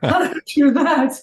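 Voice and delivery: high, bewildered question; amazed wonder. Retold or imitated. Runner puzzling over his time, high and amazed. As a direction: marvel at it, still stunned. Retold seeing finish time on watch.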